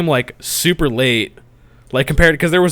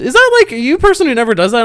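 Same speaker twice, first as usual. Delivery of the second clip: mock waitress voice; 11 semitones higher, 5 dB louder, faster than usual. Mocking the waitress's question.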